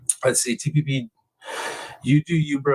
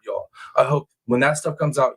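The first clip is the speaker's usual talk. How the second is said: normal talking voice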